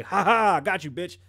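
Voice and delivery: loud mocking laugh into a sneer; mock taunt. Attacker gloating over victim, loud mock taunt.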